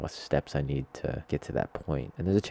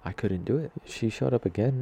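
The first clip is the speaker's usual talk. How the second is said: ordinary delivery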